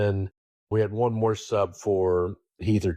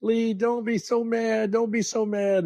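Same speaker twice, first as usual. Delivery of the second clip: imitating Marcia; 10 semitones higher than usual. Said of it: "and she's all".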